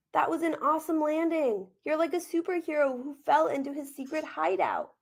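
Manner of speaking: a hint of an eager four-year-old; little boy cheering his muddy brother; upbeat little boy.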